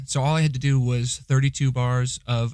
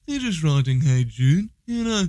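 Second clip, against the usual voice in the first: high, swooping Liverpudlian lilt; 6 semitones higher than usual. Acted. Party-piece impression for partner, bad swooping Liverpool musician voice. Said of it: "I can do a bad [name]".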